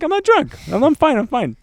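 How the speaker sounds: high, loud protest of sobriety; overinsistent denial. Retold or imitated. Recalling being convinced he was sober, mock insistent denial. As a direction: insist in a squeaky, hammed-up panic.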